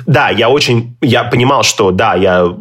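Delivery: usual speaking voice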